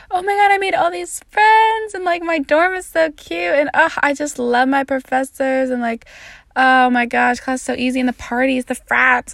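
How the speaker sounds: high, bubbly imitation of gushing friends; mock their over-the-top excitement. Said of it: "everyone else I know is just like".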